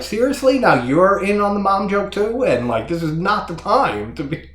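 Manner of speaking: high-pitched exasperated protest; mock incredulous. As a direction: act out disbelief at an ill-timed joke. Acting out exasperation at the joke.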